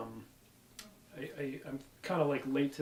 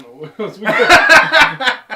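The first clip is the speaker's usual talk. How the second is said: loud, slow, laughing words; 9 semitones higher, 23 dB louder, much slower than usual. Real, not acted. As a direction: laugh through the line. Laughing at running out of topics.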